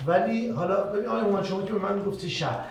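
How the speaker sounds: ordinary delivery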